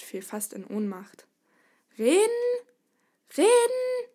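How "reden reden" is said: very high, loud shriek; screamed in disbelief. A narrator's reading. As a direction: shriek the word as an outraged question. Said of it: "schrie er". (entsetzt schreiend)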